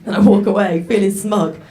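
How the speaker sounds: ordinary delivery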